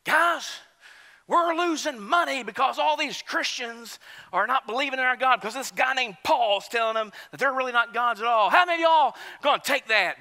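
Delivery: high, loud rabble-rouser; rallying the crowd. Acted. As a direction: fire up a mob like an aggrieved ringleader. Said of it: "He rallies them up".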